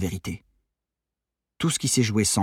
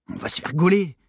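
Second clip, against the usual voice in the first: higher-pitched worried objection; protesting; 8 semitones higher than usual. A narrator's reading. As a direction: protest against a risky plan. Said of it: "protesta Maddy". (protestation d'adolescente)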